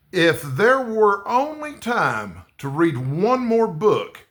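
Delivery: slow, deliberate reading of a question; reading the prompt. Acted. Vlogger reads the book tag question to viewers, slow and deliberate. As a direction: read it out slowly like a quiz card.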